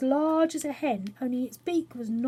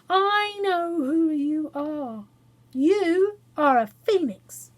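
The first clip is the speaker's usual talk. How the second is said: slow, loud, higher announcement; proud discovery; 6 semitones higher, 7 dB louder, slower than usual. A narrator's reading. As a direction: reveal it slowly and proudly. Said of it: "He said so".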